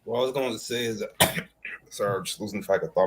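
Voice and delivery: talking while smoking; words around a cigarette; cigarette in mouth. Real, not acted. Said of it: "I'm smoking a cigarette right now".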